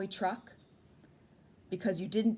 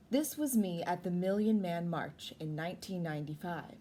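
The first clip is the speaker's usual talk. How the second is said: ordinary delivery, nothing marked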